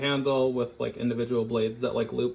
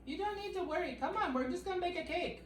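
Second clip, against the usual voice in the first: high soft soothing; 6 dB quieter than usual. Real, not acted. Soothing the dog.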